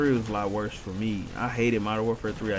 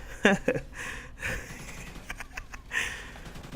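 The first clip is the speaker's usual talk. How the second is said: soft, breath-heavy laughing fit; long wheezy chuckle; amused laugh; 8 semitones higher, 7 dB quieter than usual. Real, not acted. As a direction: laugh quietly and helplessly, mostly on air. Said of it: "this is too hilarious".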